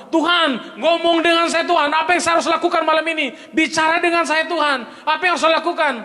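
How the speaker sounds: high, hurried mock plea to God; mock pleading prayer. A sinner begs God to speak, mock pleading. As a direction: whine a prayer for answers, a bit hammed-up. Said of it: "engkau berdoa".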